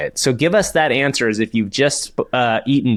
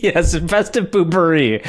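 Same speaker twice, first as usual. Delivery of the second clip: laughing; 5 semitones higher, slower than usual. Real, not acted. Laughing at poopery joke.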